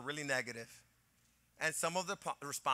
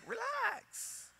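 much higher, soft and drawn out; dismissive relax; 7 dB quieter than usual. Retold or imitated. Husband waving off his wife's warning, breezy and high. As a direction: brush her worry off lightly. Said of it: "I go".

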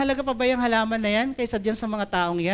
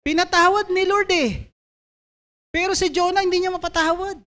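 emphatic exclamation; 9 semitones higher than usual. Real, not acted. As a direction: proclaim it like it astonishes you. Preacher exclaims God forgave, Jonah didn't.

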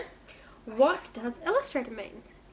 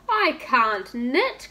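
king wailing; 6 dB louder, much slower than usual. Acted. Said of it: "cried the king".

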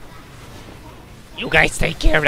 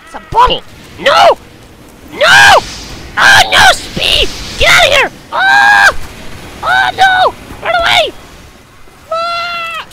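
panicked high shouting; slower than usual. Acted. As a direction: shriek in panic as you flee. Panicked in game fight.

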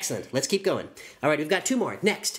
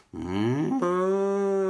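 cow moo. Shown as a demonstration. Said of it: "make a cow pose and a cow face and say".

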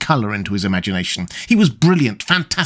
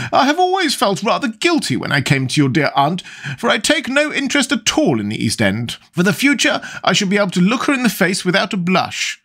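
a hint of a fussy older lady, higher; lightly voiced chattering duchess; 8 semitones higher than usual. The Duchess confiding to Lord Henry, lightly voiced and fussy. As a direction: prattle on like a flustered duchess.

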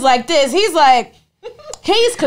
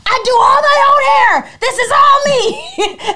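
high loud laughing brag, slowing at the end; to the clerk, laughing and shouting; laughing proud shout; 7 dB louder, slower than usual. Retold or imitated. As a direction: show off through laughter. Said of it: "I was like".

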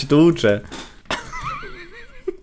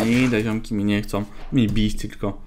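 ordinary delivery

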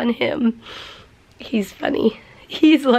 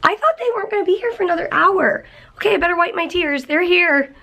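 high, rapid, caught off guard; flustered surprise; 7 semitones higher, faster than usual. Real, not acted. Owner thinking visitors came an hour early, flustered. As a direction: rush it out in a high voice.

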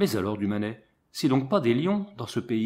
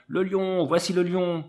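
panicked crowd cry; 6 semitones higher than usual. A narrator's reading. Fleeing villagers warn the street of the lion, frantic shouting. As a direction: cry it out as people run for their lives. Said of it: "et criant".

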